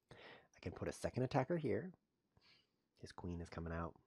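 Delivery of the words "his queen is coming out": ordinary delivery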